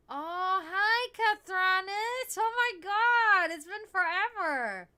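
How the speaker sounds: loud, high, slow delighted hello; excited greeting. Real, not acted. Streamer greets a returning viewer, loud high and delighted.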